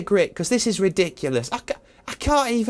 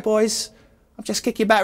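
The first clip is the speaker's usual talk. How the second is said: ordinary delivery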